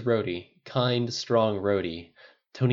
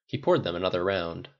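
ordinary delivery, nothing marked

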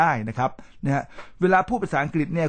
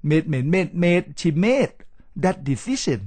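"made" repeated slowly, climbing, then "that decision" falling; tone drill; 3 semitones higher, much slower than usual. Shown as a demonstration. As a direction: drill "made" over and over with exaggerated tone. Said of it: "จะเป็นเสียงโทและเสียงตรีเป็นหลัก". (ไล่เสียง "made" ซ้ำช้าๆ แล้ว "that decision" เสียงตก)

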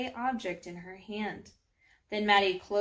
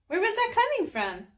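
high, louder, startled question; baffled; 13 semitones higher, 5 dB louder than usual. Acted. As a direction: ask it in rising bewilderment. Girl baffled by strange sound.

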